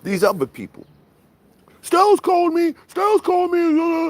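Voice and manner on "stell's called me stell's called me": high whining voice; whiny mock voice. Streamer mocking callers whining about being called. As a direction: whine it like a crybaby.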